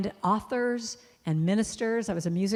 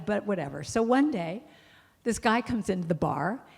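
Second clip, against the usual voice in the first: ordinary talk, nothing marked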